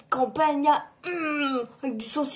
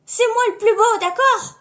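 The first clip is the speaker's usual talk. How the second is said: mock indignant; 8 semitones higher, 9 dB louder than usual. Insisting she's the handsomest.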